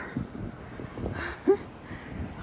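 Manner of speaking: breathless unvoiced giggle that climbs; laughing at herself. Real, not acted. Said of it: "I'm laughing at myself".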